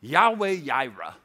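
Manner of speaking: "Yahweh Yireh" slowly, both words starting with Y; Y pronunciation. Shown as a demonstration. Preacher teaching the divine name, "Yahweh Yireh" with Y sounds. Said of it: "Ditch the J".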